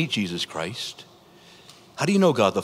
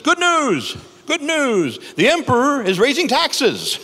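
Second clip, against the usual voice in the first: much higher, louder proclamation; hammed-up town crier announcing news; 13 semitones higher, 5 dB louder, slower than usual. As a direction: cry it out to the whole town square. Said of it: "the town crier comes and he reads".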